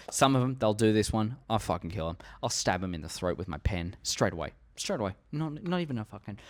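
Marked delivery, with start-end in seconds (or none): none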